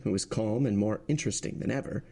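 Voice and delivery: ordinary delivery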